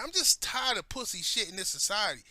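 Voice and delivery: ordinary delivery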